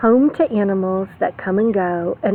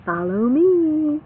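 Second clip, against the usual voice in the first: worm voice; 7 semitones higher than usual. Acted. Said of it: "The worm says".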